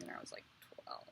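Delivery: unvoiced hush on the age; whispered aside. Real, not acted. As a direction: drop to a whisper, sheepish. Embarrassed aside about her age.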